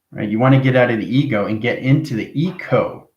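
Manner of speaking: ordinary talk, nothing marked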